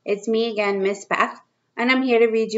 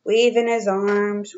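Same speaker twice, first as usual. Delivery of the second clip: ordinary delivery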